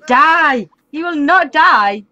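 loud, high command turning to slow complaint; frustrated gamer's protest; exasperated outburst. Real, not acted. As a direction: shout it, then stress every word in frustration. Game character refuses to die.

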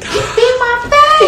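laughing squeal. Real, not acted. Friends retelling a fight, squealing with laughter.

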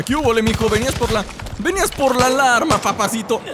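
high, loud mocking tease; gleeful taunt. Real, not acted. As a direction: crow over a foiled enemy.

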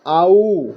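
teacher modelling "আউ", ah sliding into oo; "আউ" drawn from ah to oo; "আউ" sound demonstrated. Shown as a demonstration. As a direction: say "আউ" as one gliding ah-oo sound. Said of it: "ও ইউ এর উচ্চারণ হয়".

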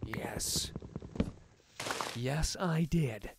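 soft guilty whisper. Real, not acted. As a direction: breathe it like a confession. Admits making a psychopath axe.

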